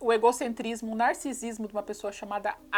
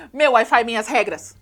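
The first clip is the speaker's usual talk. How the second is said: louder mock declaration; mock triumphant; 7 dB louder than usual. Vlogger voicing the girl's petty revenge, loud mock triumph. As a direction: proclaim it like a petty victory.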